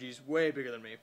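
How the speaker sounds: plain reading voice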